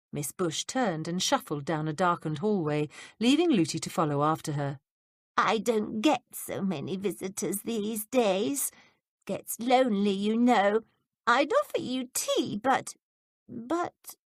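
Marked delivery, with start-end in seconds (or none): 5.4-14.2 put-on quavery old woman. A narrator's reading. Old Miss Bush voiced.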